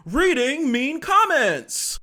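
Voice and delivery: high, loud, drawn-out title call; big stretched-out announcement; segment announcer. Pretend. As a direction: belt out the segment name like a show title.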